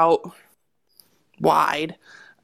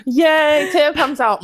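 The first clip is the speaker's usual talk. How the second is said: higher and louder, a hammed-up "Yay"; mock cheer; 8 semitones higher, 5 dB louder, slower than usual. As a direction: cheer as if the room were celebrating. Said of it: "and it's like".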